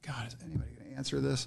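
quick, hushed, fed-up aside to himself; muttered exasperation. Retold or imitated. Alone at work, phone ringing, muttered fast and fed up. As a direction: grumble it under your breath, fast. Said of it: "I'm like".

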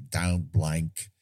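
a mock Australian take on Michael Caine; low, flat Australian-accented Michael Caine line. Host quoting Michael Caine's acting advice, in an Australian accent. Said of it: "I don't know why I did it with an Australian accent".

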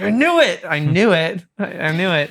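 high, loud, gleeful told-you-so; triumphant I knew it. Retold or imitated. Son crows at parents announcing divorce. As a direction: crow it like you called it. Retold reaction to divorce news.

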